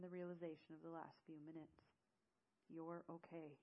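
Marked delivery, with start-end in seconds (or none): 2.7-3.6 soft, reassuring murmur; consoling. Acted. Eamon hugging the shaking woman, consoling softly. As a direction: console gently, keeping it soft. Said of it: "Eamon consoled me".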